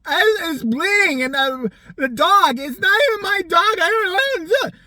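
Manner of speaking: high, loud panicked babbling; freaking out. Retold or imitated. Owner's friend freaking out at emergency vet, panicked. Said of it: "I'm fucking freaking out".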